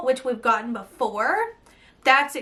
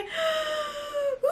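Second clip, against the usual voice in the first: very high gasping squeal; excited squeal; 13 semitones higher, 6 dB quieter than usual. Real, not acted. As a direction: shriek with delight. Sees the palette.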